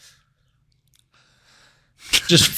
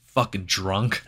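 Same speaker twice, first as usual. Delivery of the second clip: low, quiet, dismissive scoff; 7 semitones lower, 9 dB quieter, slower than usual. Retold or imitated. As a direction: scoff it under breath. Retold dismissive mutter.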